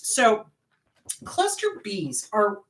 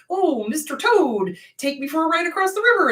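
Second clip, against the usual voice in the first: sweet-talking scorpion; 6 semitones higher, 4 dB louder than usual. Acted. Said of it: "the scorpion's like".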